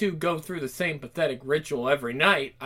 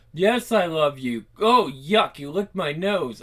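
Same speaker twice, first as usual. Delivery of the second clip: ordinary delivery, nothing marked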